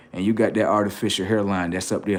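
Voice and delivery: ordinary talk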